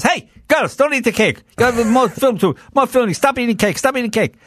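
put-on Russian accent, high, telling the girls off; Boris scolding. Boris orders the girls off the cake, high Russian scolding. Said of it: "And the guy goes".

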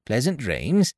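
ordinary delivery, nothing marked

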